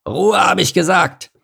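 high-pitched, drawn angry command; angry order. A narrator's reading. Rhino stamping beside meerkat, angry order. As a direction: demand silence, losing patience.

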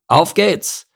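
high rallying shout; calling out. A narrator's reading. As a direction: call the troops onward, voice lifted. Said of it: "rief er den anderen zu".

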